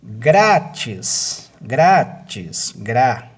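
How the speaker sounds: "grátis" loud, GRA drawn out; GRAAAtis, punched first syllable; stress on GRA. Shown as a demonstration. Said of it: "A sílaba tônica é o gra".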